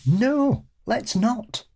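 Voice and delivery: high-pitched scoff; high dismissive refusal. Retold or imitated. Retort to the mock suggestion.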